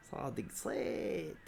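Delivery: slow whisper, drawn out; whispered aside. Real, not acted. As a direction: breathe it slowly without voice. Quiet drawn aside about a player.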